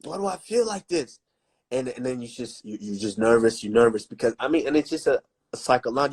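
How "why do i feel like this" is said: panicked. Retold or imitated. Panicked inner voice before fight.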